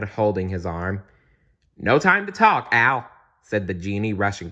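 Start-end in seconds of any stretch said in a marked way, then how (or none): 1.8-3.1 high, loud rush; hurried excitement. A narrator's reading. Genie rushing past Aladdin, hurried and excited. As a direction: blurt it out on the way past.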